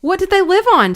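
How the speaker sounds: high, loud eager question. Acted. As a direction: ask it keenly, leaning in. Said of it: "took great interest in questions".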